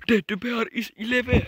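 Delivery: loud startled cry; shocked shout. Real, not acted. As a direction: blurt it out in shock. Discovers teddy bear alive.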